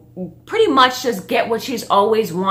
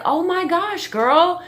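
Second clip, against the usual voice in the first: high impressed gush; retold amazement, pitched up; amazed; 9 semitones higher, slower than usual. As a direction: marvel at the little girl. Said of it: "I'm just looking at her like".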